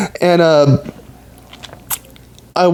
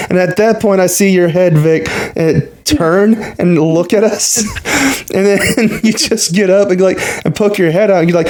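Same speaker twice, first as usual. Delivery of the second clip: ordinary talk, nothing marked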